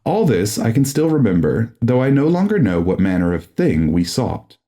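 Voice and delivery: usual speaking voice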